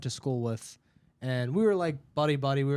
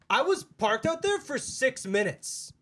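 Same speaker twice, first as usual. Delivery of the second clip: high, drawn-out customer whine; mock indignant complaint; 9 semitones higher than usual. Customer complaining to Haley about the wait, mock indignant. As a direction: imitate an aggrieved customer, pitched way up.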